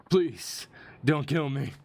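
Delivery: high-pitched plea for mercy; acted begging. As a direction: beg for your life in a high acted voice. Voicing a beaten attacker begging.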